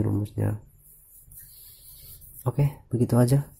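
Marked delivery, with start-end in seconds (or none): 2.4-3.5 speaking through a hoarse, scratchy throat; hoarse, with a frog in the throat. Real, not acted. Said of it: "suara saya serak". (suara serak)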